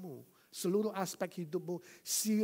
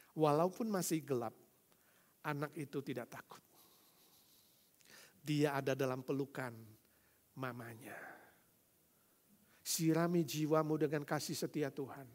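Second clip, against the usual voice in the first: normal talking voice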